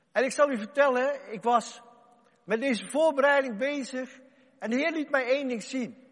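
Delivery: loud, high rousing sermon; preacher urging the church to cheer; preaching at full force. Acted. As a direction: fire up the congregation to rejoice.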